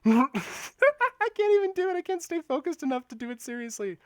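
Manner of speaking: high, loud cracking-up voice, settling; laughing too hard. Real, not acted. Streamer failing a T-Rex impression, laughing too hard to continue. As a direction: burst out laughing at your own failed attempt. Cracks up at failed monster growl.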